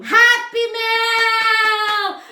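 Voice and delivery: very high, loud, drawn-out cheer; ecstatic shout. Real, not acted. Vlogger announces mail to viewers, ecstatic shout. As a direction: belt it out long and high.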